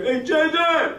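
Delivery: high-pitched holler of recognition; imitated calling out. Fan spots the guitarist in Grand Central, calling out. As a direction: call out high like an eager fan. Stranger calls out in station.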